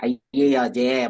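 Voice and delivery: slow, low mock Tamil accent; Madrasi accent. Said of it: "Madrasi accent".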